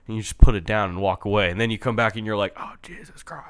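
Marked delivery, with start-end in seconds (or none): 2.6-3.4 breathy unvoiced gasp of realization; dawning realization. Gamer realizing the ending, whispered. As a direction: whisper it as it dawns on you.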